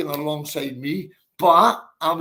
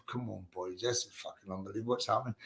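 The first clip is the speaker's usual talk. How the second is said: retold disbelief; 7 semitones lower, 12 dB quieter, faster than usual. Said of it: "I'm going".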